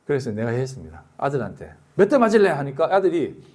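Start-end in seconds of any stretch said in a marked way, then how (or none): 1.9-2.5 high, louder stern threat; mock stern father; stern question. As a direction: demand it like a strict dad. Father asks son how many strokes.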